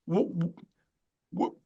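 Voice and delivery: real halting stammer on the first sound; searching for words, false starts with long gaps. Real, not acted. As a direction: get stuck on the opening word, pausing between tries. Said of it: "I'm stammering because".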